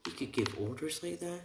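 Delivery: high-pitched incredulous question; squeaky mock disbelief; shocked. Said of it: "they're all shocked by this".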